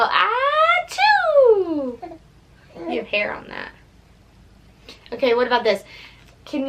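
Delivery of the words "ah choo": high playful fake sneeze "Ah, choo"; squeaky pretend sneeze for baby; mock sneeze. Shown as a demonstration.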